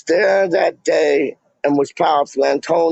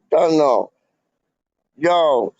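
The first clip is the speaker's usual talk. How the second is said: ordinary talk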